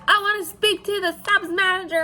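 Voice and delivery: high-pitched mock demand; annoyed customer. Annoyed lady demanding the subs manager, high-pitched imitation. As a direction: imitate an irritated customer, whiny and insistent. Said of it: "this lady just looks annoyed as fuck".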